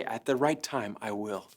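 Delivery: low, soft voice of God in his head. Retold or imitated. Pastor voicing God's answer to his prayer, low and soft.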